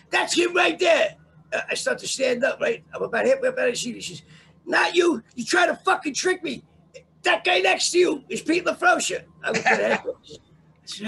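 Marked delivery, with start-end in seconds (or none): 0.1-1.1 slow, high, loud retold accusation; shouted identification. Witness pointing out the man in court, shouting high. 4.6-9.2 loud, high-pitched retold outburst; shouted accusation. Witness naming the man in the next seat, shouting.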